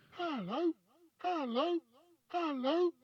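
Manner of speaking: high parrot voice from across the hall; high, far-off parrot greeting; parrot calling. A narrator's reading. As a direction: call as a distant parrot, rising each time. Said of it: "a voice came loud and clear".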